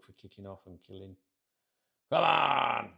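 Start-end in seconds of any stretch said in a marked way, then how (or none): 2.1-2.9 full-throated battle cry; loud, roared fan rant; rallying roar. Shown as a demonstration. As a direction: shout it like you're kicking off. Acts out tripod-days rant.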